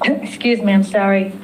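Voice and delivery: hoarse, strained, fading voice; losing her voice. Real, not acted. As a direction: talk through a voice that's going. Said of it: "I'm losing my voice".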